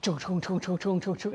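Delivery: low, soft mechanical chugging sounds; truck noise imitation. Imitating the passing baler truck for viewers. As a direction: mimic the truck rumbling past. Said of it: "you hear it goes".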